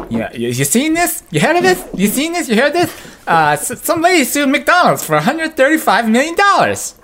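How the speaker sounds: very high-pitched late-night host voice; Jay Leno impression. Podcaster mocking late-night hosts' jokes about a lawsuit, in a high Leno impression. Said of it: "I don't have a very good Jay Leno impression".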